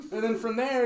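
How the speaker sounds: ordinary delivery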